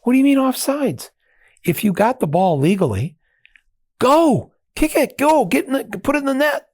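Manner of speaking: high, fast, bewildered protest; excited disbelief. Retold or imitated. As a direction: push back, can't believe the rule. American argues with British fan.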